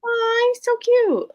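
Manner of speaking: very high, drawn-out squeal; gushing over cuteness. Real, not acted. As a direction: squeal slowly with delight. Gushing over cute tea strainer.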